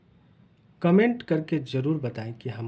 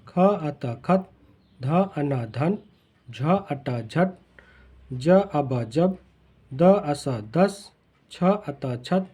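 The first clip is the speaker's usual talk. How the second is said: slow, each letter sounded, then the whole word; slow letter-by-letter spelling; much slower than usual. Shown as a demonstration. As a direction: spell out every word for a beginner, then blend it. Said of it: "तो पहले तोड़ कर पढ़ना सीखेंगे".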